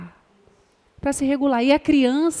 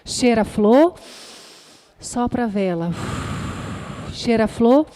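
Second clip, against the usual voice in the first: calm breathing demo; 3 semitones lower than usual. Shown as a demonstration. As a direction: lift on flor, let sopra fall like a breath out. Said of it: "vai ensinar de uma maneira lúdica". (demonstração calma de respiração, pausada)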